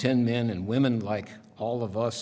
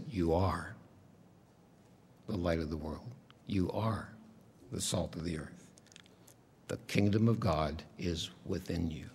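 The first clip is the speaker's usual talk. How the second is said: soft whispering tone; 4 semitones lower, 6 dB quieter than usual. Retold or imitated. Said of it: "in that whispering tone".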